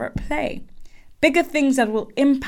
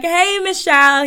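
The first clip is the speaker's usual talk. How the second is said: higher, louder, cheery greeting; pumped CEO; 8 semitones higher, 5 dB louder than usual. Retold or imitated. CEO greeting his star hire, pumped. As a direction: greet her like a hyped-up boss. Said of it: "He's really pumped".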